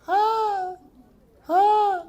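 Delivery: high yelps mimicking the wife's scolding; mock yelp. Husband mimics his scolding wife with high yelps. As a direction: squeal in mock alarm.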